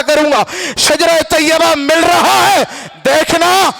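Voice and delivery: sustained, ringing sermon oratory; fervent preaching. Acted. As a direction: declaim with driving repetition, little let-up.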